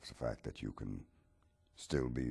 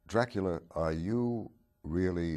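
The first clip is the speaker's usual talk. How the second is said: loud confronting question; 7 semitones higher, 9 dB louder than usual. Acted. Interviewer confronts Dracula.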